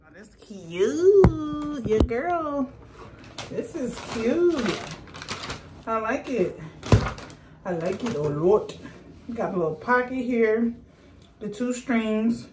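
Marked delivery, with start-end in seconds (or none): none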